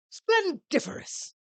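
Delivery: pompous CEO, delighted. Acted. CEO approving the visitor's name, pompous flourish. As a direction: savor the fancy word grandly.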